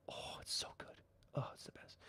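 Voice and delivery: whispered, blissful delight; raving about dragon omelets in a whisper; savoring whisper. Real, not acted. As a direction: whisper as if tasting it.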